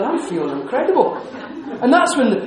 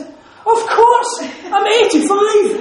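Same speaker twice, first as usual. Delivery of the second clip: much higher, delighted exclamation; exuberant positivity; 12 semitones higher, 4 dB louder, much slower than usual. Retold or imitated. Eighty-five-year-old publisher answering a compliment, exuberantly positive. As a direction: imitate her bursting with vitality, stretching the age out. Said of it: "the way she said it was positivity".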